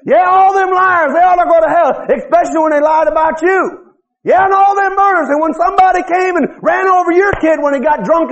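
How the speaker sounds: high, insistent pulpit challenge; forceful preaching. Real, not acted. Preacher confronting congregation over judging others' sins, forceful. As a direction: preach it hard, mimicking self-righteous accusers.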